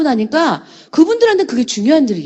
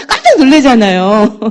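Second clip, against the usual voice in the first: louder, laughing through the words; 4 semitones higher, 5 dB louder than usual. Real, not acted. Speaker teasing the audience about their shock, laughing. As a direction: let real laughter carry the line.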